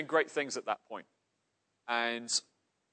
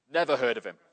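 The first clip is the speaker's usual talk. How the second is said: rough bloke; 7 dB louder, faster than usual. Acted. Bloke answering the preacher.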